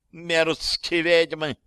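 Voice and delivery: drawn-out, high-pitched venom; spiteful cry. A narrator's reading. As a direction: spit it out slowly, with malice. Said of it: "злобно выкрикнула Виктория".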